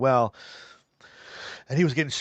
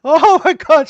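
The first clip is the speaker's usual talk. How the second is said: much higher and louder, shrieked in mock emotion; mock overwhelmed cry; 13 dB louder, slower than usual. Imagining game show crowd bawling, mock shriek. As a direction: squeal it like a contestant in tears.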